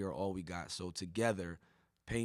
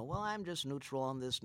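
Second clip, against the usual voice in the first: mocking neutral voice; 4 semitones higher than usual. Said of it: "There's no".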